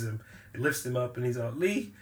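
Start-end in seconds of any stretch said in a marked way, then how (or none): 1.6-1.9 higher call building louder and faster; mock panic. Podcaster retelling Carter lifted up and calling Lee, mock panic. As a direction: plead for backup, hammed up. Carter grabbed, calls for help.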